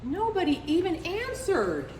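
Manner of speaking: plain reading voice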